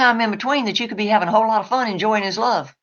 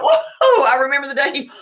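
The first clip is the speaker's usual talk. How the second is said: squealing high burst of joy; a hammed-up happy gasp, then chatter; mock delighted owner; 5 semitones higher than usual. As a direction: play the giddy owner who just remembered. Mimicking owner's happy reminiscing.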